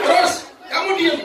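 high, loud outraged question; indignant husband. Acted. Husband scolds his wife over the stolen TV, outraged.

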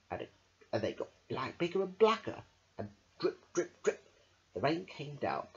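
ordinary delivery, nothing marked

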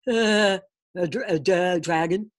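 stunned father tripping over the word; halting, startled stutter; stammering. A narrator's reading. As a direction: stammer and stick on the first syllable. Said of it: "stammered his father".

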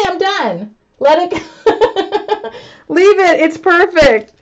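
normal talking voice

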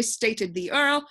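ordinary delivery, nothing marked

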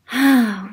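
a big audible sigh, falling; loud storybook sigh. Acted. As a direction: heave it out for the kids. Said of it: "Ernie sighed".

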